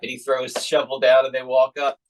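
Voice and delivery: ordinary talk, nothing marked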